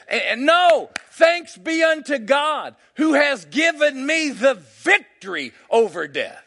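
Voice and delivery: loud, declamatory preacher defying death; full-force preaching. Real, not acted. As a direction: proclaim it with rising force, punching each phrase.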